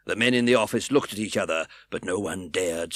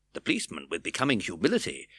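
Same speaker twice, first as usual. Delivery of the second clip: ordinary delivery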